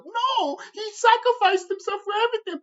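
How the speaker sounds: high, mock-earnest defender voice; mocking fans. Mimicking fans who defend Cartman, high and mock-earnest. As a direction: parody a fan excusing him. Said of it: "people would be like".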